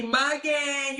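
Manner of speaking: usual speaking voice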